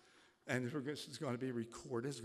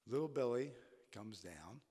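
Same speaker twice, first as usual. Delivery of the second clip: ordinary talk, nothing marked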